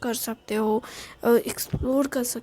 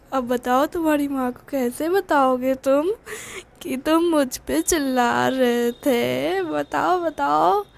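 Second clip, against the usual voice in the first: taunting ghost; 2 semitones higher than usual. A narrator's reading. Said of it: "उसके कान में घूमते घूमते".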